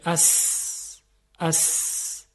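a long hissed «اس» with a sharp s; hissing s sound. Shown as a demonstration. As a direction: hiss out «اس» to show the whistle of sin. (صدای سین کشیده و صفیردار «اس»)

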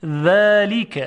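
ذلیک with a light, thin voiced th; delicate dhal. Shown as a demonstration. Teacher drilling for comparison, delicate ذلیک. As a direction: draw out ذلیک, tongue tip light on the teeth. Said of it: "صدا ظریف هست".

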